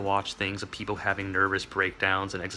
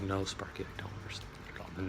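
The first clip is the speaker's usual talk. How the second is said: soft unvoiced whisper; whispered confession; 3 semitones lower, 12 dB quieter, faster than usual. Speaker to Sparky, whispering the confession. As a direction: whisper the admission sheepishly.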